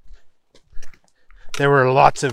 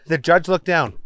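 usual speaking voice

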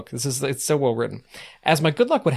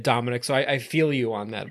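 normal talking voice

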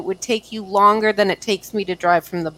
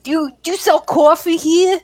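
high, hammed-up New York accent; exaggerated New Yorker asking for coffee; mock New York accent; 10 semitones higher than usual. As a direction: put on a broad New York "cawfee". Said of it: "New York accent".

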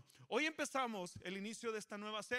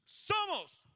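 much higher, much louder shout of Somos; battle-cry shout; 11 semitones higher, 10 dB louder than usual. Shown as a demonstration. Pastor rallies the congregation like soldiers, shouting. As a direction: yell it like charging into war. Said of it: "vamos a hacerlo fuerte".

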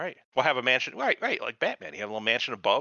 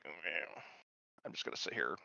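mumbled under the breath, much softer; a passive mom mumbling along; limp mumble; 9 semitones lower, 17 dB quieter than usual. Retold or imitated. Mom giving in to everything, mumbling softly. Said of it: "She's just kinda like".

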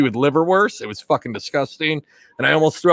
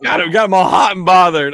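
teasing shout; 3 semitones higher, 6 dB louder than usual. Real, not acted.

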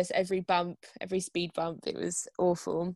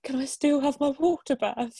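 higher, innocently hopeful question; naive asking; 6 semitones higher, 4 dB louder than usual. Retold or imitated. Woman in labour asking midwives for her water bath, naively. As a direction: ask it like you honestly expect a yes. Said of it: "I kind of naively".

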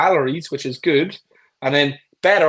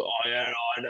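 sheepish client; 5 semitones lower, 11 dB quieter, slower than usual. Acted.

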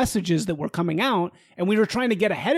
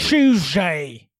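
louder, drawn-out British-accent mimicry; mock British accent; 5 dB louder than usual. Co-host echoing the reporter's accent, mock British. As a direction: belt it in a hammed-up British accent. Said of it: "muddled British accent".

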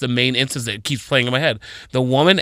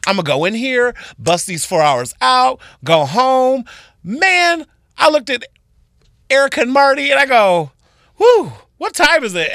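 high, louder breezy confidence; upbeat eager self; 11 semitones higher, 4 dB louder than usual. Retold or imitated. Starting a four-hour shift, high eager breezy voice. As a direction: bounce in ready for a short shift. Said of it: "I was like".

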